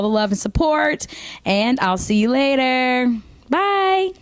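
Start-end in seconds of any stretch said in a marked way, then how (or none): 3.5-4.1 much higher sing-song bye; cheery bye. Real, not acted. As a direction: chirp it off. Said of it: "sign-off".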